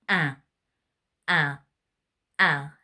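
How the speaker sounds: pinched-nose nasal vowel, repeated; a row of nasal duck quacks; nasal duck sound. Shown as a demonstration. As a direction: honk like a duck through your nose. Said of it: "Can you hear that really nasal sound?".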